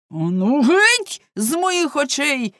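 loud, high, drawn-out dismissal; angry cry. A narrator's reading. Baker orders servant out of his sight, angry cry. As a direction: drive him away with a long yell. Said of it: "скрикнув пекар".

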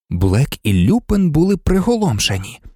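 ordinary delivery, nothing marked

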